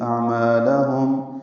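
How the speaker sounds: اعمالہم very slow, closing the lips on the final میم; tajweed demo. Shown as a demonstration. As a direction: end اعمالہم with closed lips. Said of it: "میم ساکن ہونٹ بند کر لیں گے". (اعمالہم ہونٹ بند کر کے)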